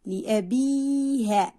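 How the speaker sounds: "لأبيها" slowly, with a long held bii; long vowel demo. Shown as a demonstration. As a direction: stretch the ii in "لأبيها" right out. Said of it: "كلمات تحتوي على حرف مد بالياء".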